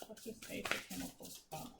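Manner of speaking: whispered question to the cards. Real, not acted. Reader whispers her question to the tarot deck.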